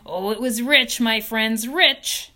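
high, loud, relishing each word; gloating. Acted. Rat boasting to farm friends, loud and gloating. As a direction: savour the memory of the feast.

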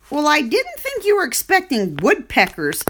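dry, bitter pig retort; a touch of bitter sarcasm. Acted. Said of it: "said Wilbur bitterly".

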